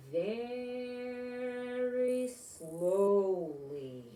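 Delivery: drawn out extremely slow and high; very slowly. A narrator's reading. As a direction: stretch every syllable like a snail. Narrating snail's slow answers.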